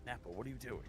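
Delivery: a hint of a quiet exasperated ask; Vegeta impression. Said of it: "Vegeta goes".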